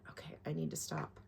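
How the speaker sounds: normal talking voice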